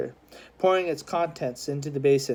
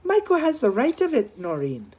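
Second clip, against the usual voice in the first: Missus O'Neill; 7 semitones higher than usual. A narrator's reading. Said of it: "Missus O'Neill put in".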